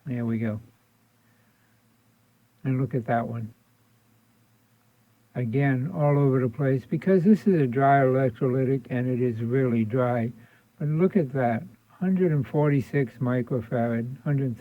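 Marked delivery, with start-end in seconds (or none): none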